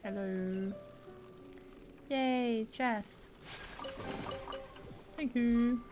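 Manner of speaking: usual speaking voice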